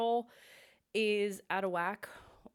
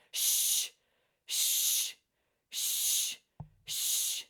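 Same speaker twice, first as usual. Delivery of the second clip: sharp, louder shh pulses; shushing; 5 dB louder, much slower than usual. Shown as a demonstration. Vocal coach demonstrating shushes from the solar plexus. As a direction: kick each shh out from the belly. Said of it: "you wanna do shushes".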